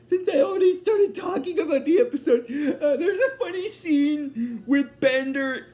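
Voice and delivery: high, giggly explanation; real leftover giggles lifting the voice; talking through laughter. Real, not acted. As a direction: try to explain while still chuckling. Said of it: "explain why I'm laughing".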